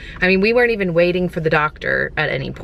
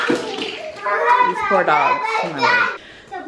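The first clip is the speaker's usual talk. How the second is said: silly high voice; 14 semitones higher, much slower than usual. Acted. Kids chasing the dogs.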